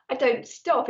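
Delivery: normal talking voice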